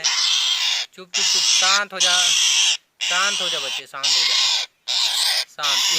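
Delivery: loud shushing of a struggling bird; loud hushing. Real, not acted. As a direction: tell the bird firmly to calm down. (काटते पक्षी को ज़ोर से चुप कराना)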